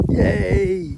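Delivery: very high, joyful cheer; excited yay. Real, not acted. Cheering on the bike trail.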